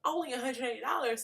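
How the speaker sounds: high-pitched mock disbelief at the price; incredulous inner reaction. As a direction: echo it back as if you can't believe it. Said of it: "And I'm thinking".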